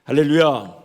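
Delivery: loud falling call of 할렐루야 to the room; hallelujah call. Real, not acted. Preacher calling out to the congregation, loud. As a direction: call 할렐루야 out to wake the crowd.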